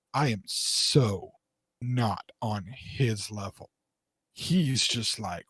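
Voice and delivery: very slow, low, barely voiced amazement; hushed awe. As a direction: retell being humbled, softly and word by word. Retold awe at actor's superior level.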